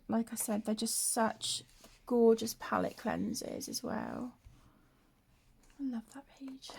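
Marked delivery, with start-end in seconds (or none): 5.8-6.7 whispering. Real, not acted. Soft aside while colouring.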